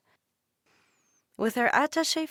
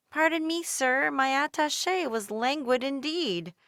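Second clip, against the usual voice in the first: higher, polite plea; polite little girl; 7 semitones higher than usual. A narrator's reading. Dolores politely asking a man reading news, higher. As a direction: ask the stranger politely. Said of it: "approached a man".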